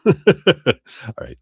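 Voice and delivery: laughing. Real, not acted. Laughs while speaking.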